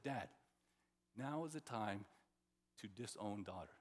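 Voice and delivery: low, hushed mock-solemn voice; solemn advice, played straight; mock serious. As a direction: quote it gravely, soft and low, with pauses. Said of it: "said it in a serious voice".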